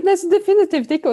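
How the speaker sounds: bursts out laughing, then answers through the laugh; high, loud laugh carrying into the reply. Real, not acted. Guest laughs at the host's e-mail joke, then answers laughing.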